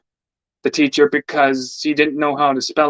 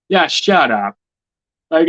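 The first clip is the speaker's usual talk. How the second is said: ordinary delivery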